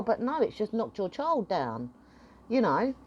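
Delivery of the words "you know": ordinary talk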